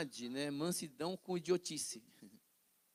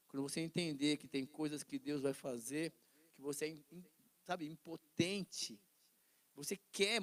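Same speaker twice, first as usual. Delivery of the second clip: ordinary talk, nothing marked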